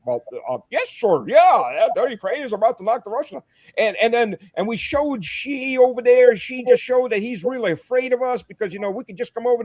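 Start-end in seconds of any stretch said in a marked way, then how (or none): 0.1-3.4 a lightly voiced eager senator, higher; mimicking a senator. Mimicking a senator at the hearing, lightly higher. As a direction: parrot him with hollow confidence. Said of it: "And he's like".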